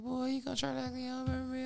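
put-on fast, high, soft scolding girl voice; imitating her. Caller scolding Mike for forgetting her, high soft imitation. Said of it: "She's like".

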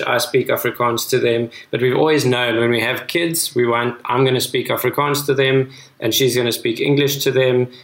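normal talking voice

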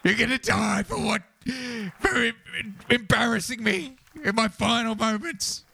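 high, anguished shout; furious shouted threat. Acted. Killington raging at the opponent who embarrassed him, high outraged shout.

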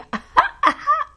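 quick amused laugh; high burst of laughter. Real, not acted. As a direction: laugh at your own twist. Laughs at own joke.

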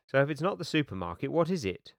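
a touch of impatience, pressing for an answer; slightly irritable. A narrator's reading. Anthea questioning Kirsty at the dinner, slightly irritable. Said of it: "asked Anthea slightly irritably".

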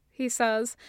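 ordinary delivery, nothing marked

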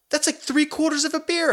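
high whiny protest about the beer; mock excuse. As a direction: squeak out the excuse.

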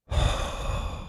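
slow audible breath out; long exhale. Shown as a demonstration. Pastor leading listeners in breathing, slow exhale. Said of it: "inhale, exhale".

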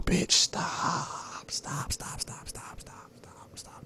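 whispered, repeated plea fading to nothing; hushed mock-exasperated begging to stop; exasperated pleading. To a crying lover turned poet, exasperated whispered pleading. Reacting to a crier's poetry.

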